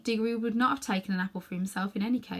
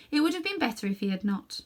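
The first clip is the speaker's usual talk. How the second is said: plain reading voice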